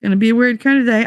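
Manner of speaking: fast Gonna be a weird kind of day; "weird kind of day" rattled off fast; brisk repeat. Pretend. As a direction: rattle it off quickly. Repeats phrase differently.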